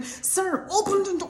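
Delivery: frightened passenger begging the driver; panicked plea. Retold or imitated. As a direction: plead to be let out.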